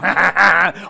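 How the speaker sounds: loud goofy put-on cackle; manic imitated noise. Mimicking camper hooked on Mountain Dew, loud cackle. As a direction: mimic an annoying kid's crazed cackle. Mimics the annoying kid guzzling soda.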